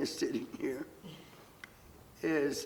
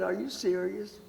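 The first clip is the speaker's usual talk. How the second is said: higher-pitched disbelieving protest, building on each phrase; imitating an appalled mother refusing to go; incredulous mother; 5 semitones higher, 4 dB louder than usual. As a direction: balk at the idea, voice climbing and louder by the end. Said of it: "My mother looked at me like I was out of my mind".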